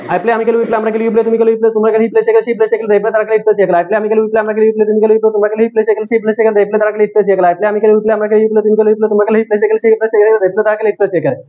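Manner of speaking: whole drill said in one breath. Shown as a demonstration. Teacher shows the drill repeated in a single breath. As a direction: keep going without stopping for breath. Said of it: "মানে এটা পাঁচবার বলেছি".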